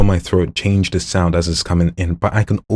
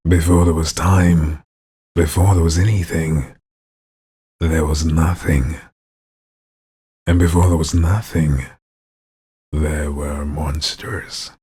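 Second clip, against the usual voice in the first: growling storyteller; slower than usual. Acted. Ominous monster narration.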